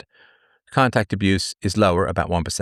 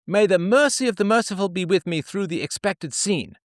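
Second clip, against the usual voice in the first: much higher, prim and devout; pioneer woman praying; 9 semitones higher than usual. Acted. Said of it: "diary entry of a pioneer woman".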